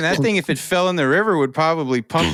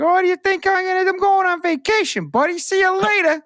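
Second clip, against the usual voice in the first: put-on thick New England accent, higher and fast; cheeky dinosaur in a New England accent. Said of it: "he says a thick New England accent".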